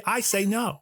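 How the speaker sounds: high, emphatic mock denial; mock-defiant declaration. Comedian answering his own masculinity question, mock-defiant. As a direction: declare it grandly for a laugh.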